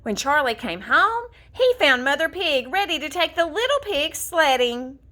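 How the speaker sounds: plain reading voice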